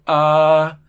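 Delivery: loud sustained "ah" showing vibration; loud voiced ah. Shown as a demonstration. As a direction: hold out a strong ah. ("ah" fuerte y sostenido)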